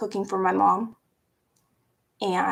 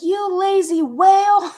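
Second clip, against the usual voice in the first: higher, louder mocking insult; brother insulting; 9 semitones higher, 7 dB louder than usual. Brother taunting her about weight, mocking higher and louder. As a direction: sneer the insult. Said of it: "And he's like".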